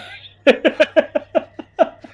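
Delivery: a long, high laugh; high loud laugh. Real, not acted. Player laughing at his own joke, high laugh. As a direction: let the laugh ring out high.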